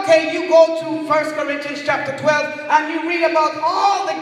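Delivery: loud, high, emphatic sermon delivery; forceful preaching. Real, not acted. Preacher warning a church of deception, forceful preaching. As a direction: preach it out, leaning hard on key words.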